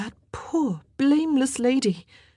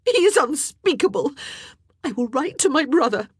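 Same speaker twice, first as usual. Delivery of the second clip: high, loud outraged queen; tearful fury; 11 semitones higher, 6 dB louder than usual. A narrator's reading. Queen raging to her ladies, high and tearful. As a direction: rail through tears, demanding help. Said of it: "burst into furious tears".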